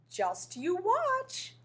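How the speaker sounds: slow, high playful promise; teasing scallop. Acted. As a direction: draw it out, teasing. Scallop suppressed a smile.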